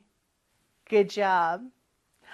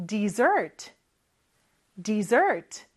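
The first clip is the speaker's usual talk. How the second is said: dessert stressed on its second syllable, pitched lower; 4 semitones lower, much slower than usual. Shown as a demonstration. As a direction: model dessert as di-ZERT for repeating. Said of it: "같이 한번 따라해볼까요".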